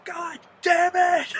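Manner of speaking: drawn-out, high-pitched furious shout; screaming. Retold or imitated. Jon Snow roars at the dragon, screaming. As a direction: scream it out slow and very loud. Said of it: "Like, screaming at it".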